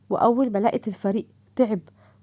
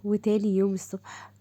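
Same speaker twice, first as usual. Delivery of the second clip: ordinary delivery, nothing marked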